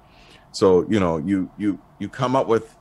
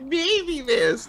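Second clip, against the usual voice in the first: imitating thinking voice. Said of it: "Is that the E where you're like".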